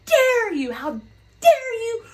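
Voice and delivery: high, loud and outraged, dragging out how dare you; furious mock shout. Angry passenger screaming at the supervisors over a child. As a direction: shriek at the staff like an entitled passenger. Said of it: "she's pissed".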